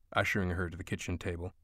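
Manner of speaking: ordinary delivery, nothing marked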